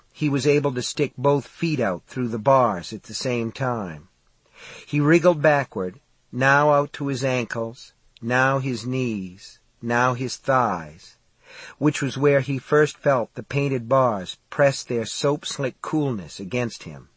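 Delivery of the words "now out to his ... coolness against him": plain reading voice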